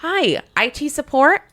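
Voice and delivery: bright mock-cheery. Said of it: "I'm like".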